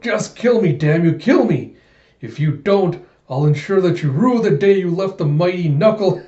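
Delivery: defiant calling out. Retold or imitated. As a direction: call it out like a hammed-up defeated villain. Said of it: "calling out".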